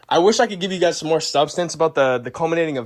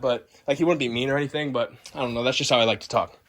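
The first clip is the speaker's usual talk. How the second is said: ordinary delivery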